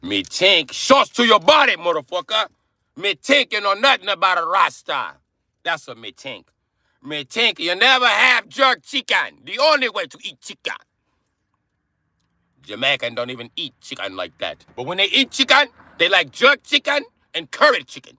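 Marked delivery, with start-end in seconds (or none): 0.0-10.8 high-pitched, worked-up Jamaican accent; hammed-up, indignant Jamaican accent. Shown as a demonstration. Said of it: "Jamaican accent sounds like me British accent". 14.8-18.2 high, animated Jamaican accent; excitable mock Jamaican. Shown as a demonstration. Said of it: "Jamaican accent sounds like me British accent".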